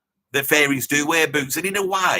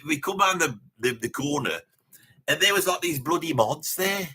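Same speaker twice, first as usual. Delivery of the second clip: ordinary delivery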